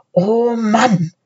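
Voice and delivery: mild, weary sigh in the words. A narrator's reading. Philipp giving in and trudging on, sighing. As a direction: let it fall like a resigned sigh. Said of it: "seufzte Philipp".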